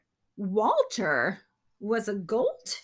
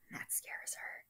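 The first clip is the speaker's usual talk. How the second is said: hushed spooky whisper; 18 dB quieter than usual. Acted. Reader whispering a spooky aside to the children. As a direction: whisper it like a secret fright.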